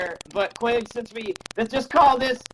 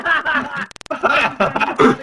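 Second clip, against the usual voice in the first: loud put-on ha ha laugh; imitated laugh; 3 semitones higher, 7 dB louder, much slower than usual. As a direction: laugh along without knowing why. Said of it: "He's like".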